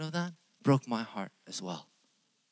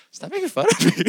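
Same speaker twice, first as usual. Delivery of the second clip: high, laughing mock complaint; playfully begging them to stop, laughing; laughing protest; 11 dB louder, faster than usual. Real, not acted. Teasing the laughing audience.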